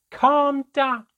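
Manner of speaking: very high, loud, shrieked retort; explosive high-pitched yell of fury; outraged scream. A narrator's reading. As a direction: scream it back in disbelief. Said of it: "My mother began to scream".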